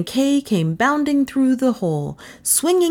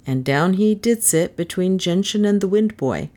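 plain reading voice

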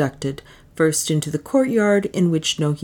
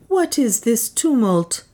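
higher, slow queenly alarm; alarmed question; 7 semitones higher than usual. A narrator's reading. Juana asking the guards about the noise, alarmed. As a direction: ask it warily as the woman.

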